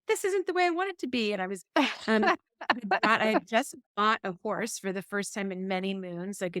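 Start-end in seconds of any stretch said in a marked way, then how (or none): 0.1-1.3 put-on high, fast, sulky little-kid whine; whiny inner child. Narrator voicing her inner child's sulky whine. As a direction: let the sulking inner child complain, quick and pitched up. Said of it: "my inner child was like".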